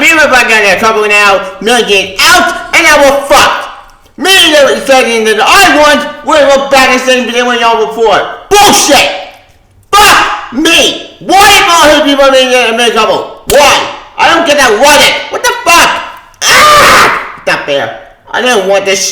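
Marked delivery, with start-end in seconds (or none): 1.6-15.9 real frustrated yelling; angry rant. Real, not acted. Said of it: "as you can tell from my angry lady". 16.4-17.4 short high yell of pure exasperation; real angry howl; frustrated scream. Real, not acted. Peak of angry rant.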